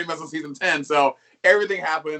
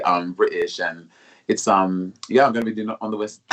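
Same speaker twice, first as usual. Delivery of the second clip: put-on British accent; 6 semitones lower than usual. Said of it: "Give me your British accent now".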